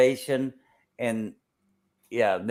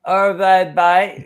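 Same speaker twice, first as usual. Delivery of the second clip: loud, high Aussie imitation; mock Australian accent; 7 semitones higher, 8 dB louder, slower than usual. Copying the friend's go at Hervey Bay, Australian accent. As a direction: echo it in broad Australian. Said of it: "That's how the Australians would say".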